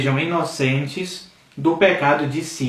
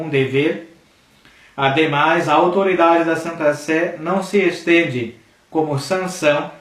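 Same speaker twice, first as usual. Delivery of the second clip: declaiming an encyclical, a little louder and emphatic; formal reading; 2 semitones higher, 4 dB louder than usual. Acted. Teacher reading an encyclical to his viewers, in solemn declaiming. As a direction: read the Pope's words out like a solemn proclamation.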